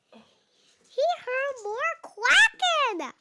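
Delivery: high, loud and slow; child thrilled by the quacking; excited. Acted. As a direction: stretch it out with delight.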